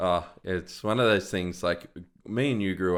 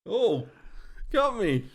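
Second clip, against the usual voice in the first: laughing through a wheeze, much higher; wheezy laugh; 13 semitones higher, slower than usual. Real, not acted. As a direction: caught off guard, wheezing with laughter. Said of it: "You hear that wheeze?".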